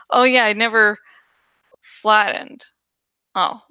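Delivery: usual speaking voice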